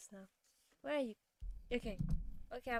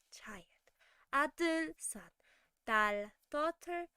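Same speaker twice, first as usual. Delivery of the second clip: ordinary talk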